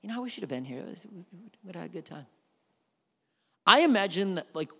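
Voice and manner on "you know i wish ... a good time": light, softer mock-casual thanks; a sarcastic picture of an unbothered Martha; breezy polite host. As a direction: play it mockingly nonchalant. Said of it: "I cannot imagine Mary and Martha being like".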